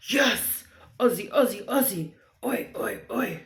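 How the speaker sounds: loud, punchy crowd-style chant; short, punched shouts of national pride; Aussie cheer. Pretend. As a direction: belt out a playful Australian sports cheer. Performs a national chant for viewer.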